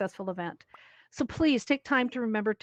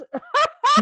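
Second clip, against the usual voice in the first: high burst of laughter; 9 dB louder than usual. Real, not acted. Audio trouble joke.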